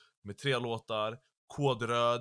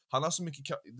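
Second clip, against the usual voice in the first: normal talking voice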